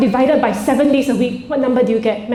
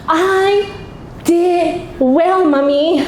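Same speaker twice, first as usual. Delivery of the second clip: put-on child voice; 4 semitones higher, much slower than usual. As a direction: chirp it like a proud eight-year-old. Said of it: "and she goes".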